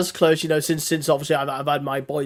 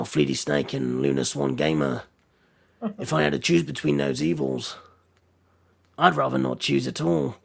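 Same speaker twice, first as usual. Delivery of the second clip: slow, gravel-free lightly voiced Witcher; a hint of a grim monster hunter, slow and measured; favourite impression; 3 dB quieter than usual. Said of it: "my absolute favourite impression".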